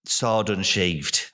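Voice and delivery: usual speaking voice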